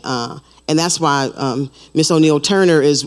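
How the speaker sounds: ordinary delivery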